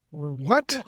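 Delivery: disbelieving, rising what; stunned what. Retold or imitated. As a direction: react as if you misheard. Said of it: "I was like".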